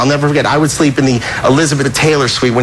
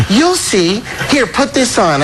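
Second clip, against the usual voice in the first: lightly higher-pitched imitation; 6 semitones higher, slower than usual. Prankster keeping his plan from his guest, lightly imitated. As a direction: quote him with a slight lift in pitch. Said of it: "He goes".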